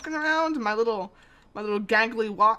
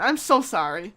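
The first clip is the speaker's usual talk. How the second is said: ordinary delivery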